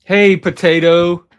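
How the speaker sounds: loud slow call to a pet. Real, not acted. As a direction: call the dog's name, drawn out. Calling the dog on stream.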